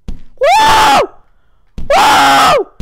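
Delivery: high, loud whooping cheer; wild gamer whoop; excited whoop. Real, not acted. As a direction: let out a big whoop of joy. Cheering at game jump.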